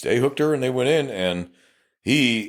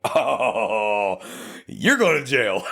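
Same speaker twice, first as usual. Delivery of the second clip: laughing call-out; much slower than usual. Retold or imitated. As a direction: crack up while telling her she's going to jail. Catching wife lying, calls it out.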